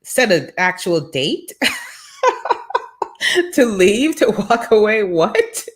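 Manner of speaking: incredulous, rising into a laugh; half-laughing shock at the coach; retold disbelief. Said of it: "I was like".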